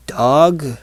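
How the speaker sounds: ordinary delivery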